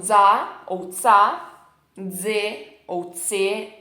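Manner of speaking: slow, loud drill of "za ou tsa"; z and ts syllables. Shown as a demonstration. Teacher drilling the z syllables, slow and loud. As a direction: drill "za ou tsa" slowly, each sound clear. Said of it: "Então".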